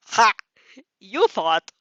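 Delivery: loud sarcastic "ha, you thought"; mocking scoff. Reader mocking the story's own line, loud scoff.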